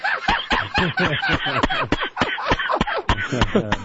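long high-pitched laughing fit; real laughter. Real, not acted. Host hears the joke about lost respectability, real high laughter. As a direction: crack up helplessly at the joke.

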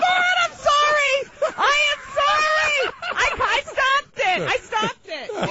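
high, slow, shouted panicked sorry; a flustered wail of apology after a mishap; frantic apology. Real, not acted. Guest panicking on air after knocking something over, high wailing apology.

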